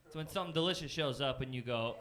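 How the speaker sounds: usual speaking voice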